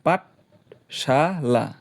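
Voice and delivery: slow, drawn-out syllables; pronounced slowly, beat by beat; syllable-split. Shown as a demonstration. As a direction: stretch out each syllable to count beats. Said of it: "এইখানে তিন আর মোর এক".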